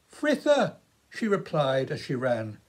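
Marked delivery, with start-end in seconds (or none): 0.1-0.8 put-on high girl's voice calling out; girl calling back. A narrator's reading. Girl calling her name to Philip as she runs. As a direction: toss the name back while running.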